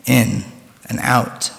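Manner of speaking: soft fading breathing cue; guided meditation. Acted. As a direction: let the breath settle, softly.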